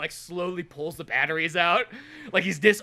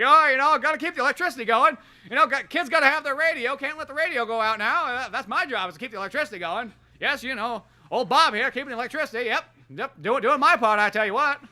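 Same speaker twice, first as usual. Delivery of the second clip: higher, put-on folksy worker voice; folksy old Bob; 7 semitones higher, 4 dB louder than usual. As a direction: play a chirpy old-timer proud of his job.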